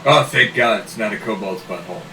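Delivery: ordinary talk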